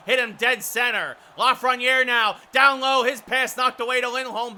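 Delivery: urgent call rising on each shot; excited play-by-play. Real, not acted. Announcer calls Lafreniere and Lindgren attacking, excited play-by-play. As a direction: punch the shots loud and high.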